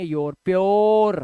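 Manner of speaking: योर, प्योर drawn out slowly; slow demo. Shown as a demonstration. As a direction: stretch प्योर so they hear the ओ. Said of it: "इसे क्या पढ़ेंगे?". (योर, प्योर को धीरे खींचकर)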